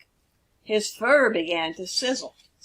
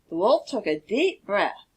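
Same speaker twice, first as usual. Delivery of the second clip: plain reading voice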